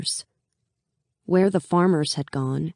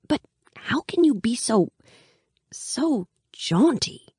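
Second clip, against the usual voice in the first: higher, drawn-out incredulous so jaunty; exasperated; 9 semitones higher than usual. A narrator's reading. Alina exasperated at Mal's bouncy mood. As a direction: stretch the second so in disbelief.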